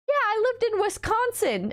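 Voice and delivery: lightly voiced Japanese speaker; high imitated local.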